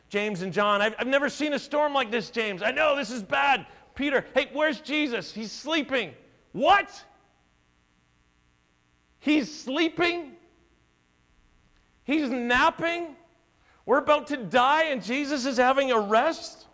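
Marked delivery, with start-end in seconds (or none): 0.8-6.1 high, quick worried chatter; panicked disciples. Acted. Fishermen panicking to each other in the storm, high and rushed. 6.5-16.7 can't believe he's asleep; incredulous disbelief. Acted. Disciple stunned that Jesus naps in the storm, high disbelief.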